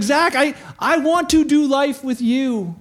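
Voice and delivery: high, slow, heartfelt appeal; eager invitation. Retold or imitated. Jesus inviting Zach in the tree, eager appeal. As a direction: call out the invitation, drawing it out.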